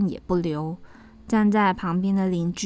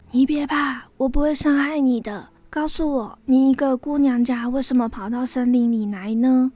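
high-pitched, gentle creature voice; reassuring snake; 5 semitones higher, 4 dB louder than usual. A narrator's reading. Rescued snake calming the girl in the forest, reassuring. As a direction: soothe her, then ask with concern.

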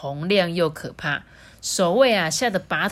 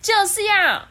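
stubborn princess; 14 semitones higher, 5 dB louder than usual. Acted. 顶嘴公主坚持.

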